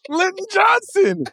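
high, laughing, fond cry of a name; nostalgic whoop. Real, not acted. Hearing an old bench player's name, high laughing delight.

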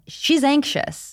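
slow, high, dismissive drawl; dismissive mock label. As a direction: say it like brushing her off. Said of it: "given the label of".

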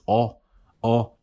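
short pure "Oh", far back, no glide; Spanish "Oh", one steady back vowel. Shown as a demonstration. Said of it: "'Cause in Spanish, it's".

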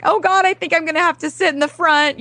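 put-on high, fast, panicky child's voice; a mother imitating her son panicking about the bus; panicked kid. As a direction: mimic a worked-up kid blurting it out. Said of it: "he's all panicked and worked up".

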